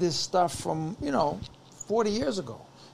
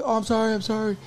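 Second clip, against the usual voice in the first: higher-pitched mock apology; raised, hurried-to-please sorry; apologetic fan; 6 semitones higher, faster than usual. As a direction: imitate a flustered fan backing off.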